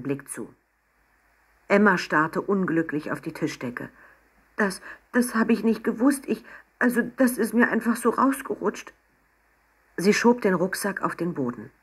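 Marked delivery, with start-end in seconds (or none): 4.6-8.9 higher, halting, apologetic voice; flustered excuse. A narrator's reading. Unhappy Emma excuses herself to the sheikh, flustered. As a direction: stumble through an embarrassed apology.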